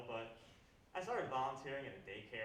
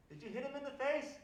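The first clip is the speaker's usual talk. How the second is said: put-on high-pitched questioning, as to a child; acted sing-song grown-up question; mock adult questioning child; 12 semitones higher, 3 dB louder than usual. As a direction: ask a little kid, voice lifted.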